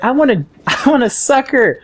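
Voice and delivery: high mock child demand with a laugh; high eager kid voice; imitating eager kid. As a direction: play the little kid begging for a sucker. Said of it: "he's like".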